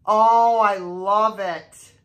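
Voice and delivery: ordinary talk, nothing marked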